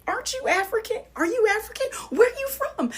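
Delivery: very high, delighted questioning; excited admirer. Retold or imitated. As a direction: squeal the questions like an eager fan. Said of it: "every time somebody says to me".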